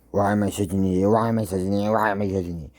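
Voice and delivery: loud, rapid repeated self-berating; imitated self-directed screaming. Fan re-enacting Jax berating himself, loud mock scream. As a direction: act out yelling at yourself, fast and loud. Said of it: "screaming at himself".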